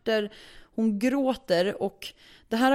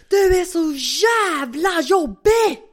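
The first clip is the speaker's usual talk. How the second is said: high, loud, drawn-out furious yell; screaming; 9 semitones higher, 8 dB louder, much slower than usual. Retold or imitated. Tenant losing it at neighbour, screaming. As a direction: scream it, stretching the words in fury. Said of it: "Bara skriker jag".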